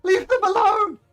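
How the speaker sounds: high, loud, frantic mother's cry; pleading mother. Acted. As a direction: shriek it out as a desperate mother. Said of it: "The mother attempts to tackle you and say".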